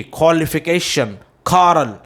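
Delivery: क्वालिफिकेशन as "kholification", कॉरल as "khorral"; KHO without W in क्वालिफिकेशन and कॉरल; right pronunciation. Shown as a demonstration. Teacher drilling pupils, क्वालिफिकेशन and कॉरल with "kho". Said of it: "और आप बोलेंगे".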